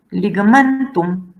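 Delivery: "Ligamentum" with the stress on MEN; second-last syllable stressed. Shown as a demonstration. Teacher showing where the Latin accent falls, stress on MEN. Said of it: "so the accent is here".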